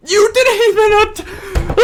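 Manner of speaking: very high, loud strangled yell; furious cracking shout; enraged laughing shout. Real, not acted. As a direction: scream in disbelief, half laughing. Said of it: "I'm actually heated".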